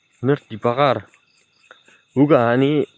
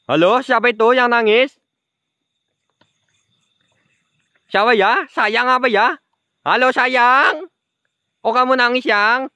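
higher, slow sweet-talking call; coaxing ghost; 10 semitones higher, slower than usual. Pretend. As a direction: coax her like a lover. Coaxing crying ghost girl. (membujuk hantu dengan manja)

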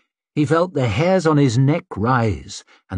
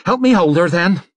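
urgent order; 7 semitones higher, 4 dB louder than usual. Acted. Urgent order during fit.

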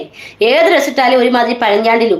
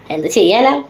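ordinary delivery

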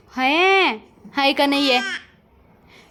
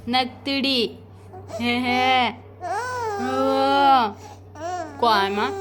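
normal talking voice

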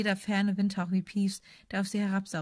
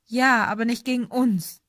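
ordinary delivery, nothing marked

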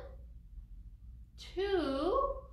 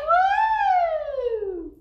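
very high, loud, long woo; whooping cheer; 14 dB louder than usual. Real, not acted. Storyteller cheers children for counting in French, high long whoop. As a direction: let out a big sustained whoop.

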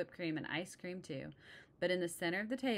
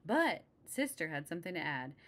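plain reading voice

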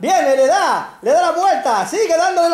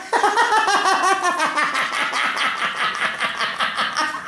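quiet drawn-out laugh; 5 dB quieter than usual. Real, not acted. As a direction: laugh softly and long. Laughing at dog.

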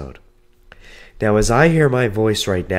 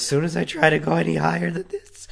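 pushing voice higher; 7 semitones higher than usual. Shown as a demonstration. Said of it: "I can talk like this".

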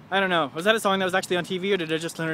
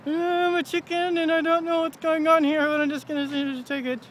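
high, slow dopey chicken voice; high, slow voiced chicken, going along with it; 8 semitones higher, slower than usual. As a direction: voice the chicken, dazed but game. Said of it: "he was all like".

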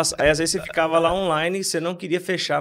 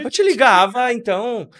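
laughing; 6 semitones higher, 8 dB louder than usual. Real, not acted. Laughing punchline about voice messages.

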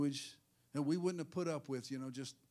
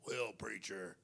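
low, slow put-on drawl; mock whiny-slow church member, pitched low; mocking excuse-maker; 3 semitones lower, 4 dB quieter, slower than usual. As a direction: drag it out like a dim objector. Mimics church member making excuses.

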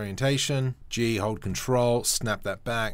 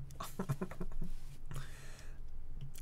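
giddy whispered laugh; 8 semitones higher, 11 dB quieter than usual. Real, not acted. After thrilled whisper.

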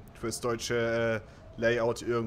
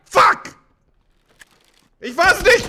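high loud shriek of denial; real panicked screaming; startled scream; 18 dB louder, slower than usual. Real, not acted. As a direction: scream that it wasn't you. Caught by enemy player.

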